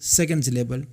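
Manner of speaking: ordinary delivery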